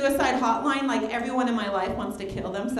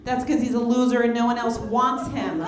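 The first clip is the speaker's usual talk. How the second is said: normal talking voice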